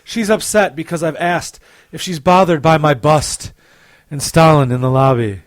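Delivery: loud, overblown romance reading; romance novel narrator. Pretend. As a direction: declaim like a bodice-ripper. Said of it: "my romance novel voice".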